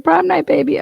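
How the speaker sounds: high, loud party cheer; mock hype. Streamer hyping the trip to chat, mock party cheer. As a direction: ham it up like it's prom night.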